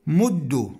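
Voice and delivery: "مد" ending in "u", muddu; damma on dal. Shown as a demonstration. Teacher reading the imperative form, "مد" with damma. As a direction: say "مد" with doubled d and "u". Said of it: "بضم الدال".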